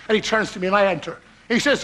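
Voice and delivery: ordinary talk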